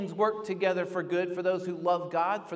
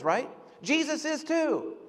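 normal talking voice

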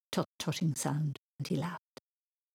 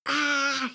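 high scream of horror; high-pitched acted shriek; terrified scream; 9 semitones higher than usual. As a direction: scream at a frightful sight. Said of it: "he screamed even louder".

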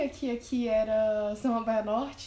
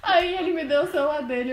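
normal talking voice

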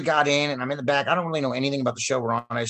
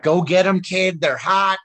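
hearty encouragement; 7 semitones higher, 7 dB louder, slower than usual. Retold or imitated. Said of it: "And he was like".

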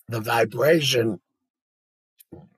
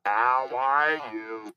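very slow buzzing device voice with gliding pitch; electrolarynx with intonation; 3 semitones higher, much slower than usual. Shown as a demonstration. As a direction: stretch it out on an electronic hum, bending the pitch. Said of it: "it actually gives you intonation".

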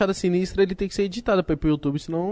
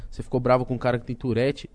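the friend's hushed mock taunt about Tourette; mocking imitation of the guy; 11 semitones lower, 6 dB quieter than usual. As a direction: lower and softer mock voice of the friend. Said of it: "Aí esse cara".

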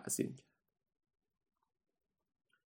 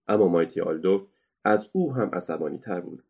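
usual speaking voice

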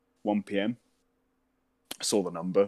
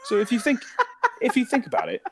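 words breaking into high laughter; laughing; 14 semitones higher, 5 dB louder than usual. Real, not acted. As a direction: laugh through the setup. Laughing while telling the story.

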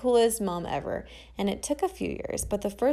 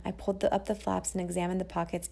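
ordinary delivery, nothing marked

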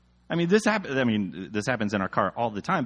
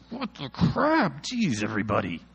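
exasperated. Retold or imitated. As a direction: groan it out, fed up with everyone. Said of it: "I'm exasperated".

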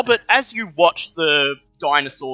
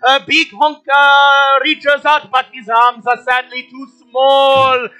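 loud, high play-by-play call; excited commentator calling the race; race announcer; 10 semitones higher, 6 dB louder than usual. Acted.

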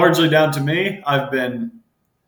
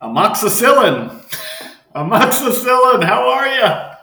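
announcer voice; 10 semitones higher, 4 dB louder than usual. Acted. Introducing co-host by nickname.

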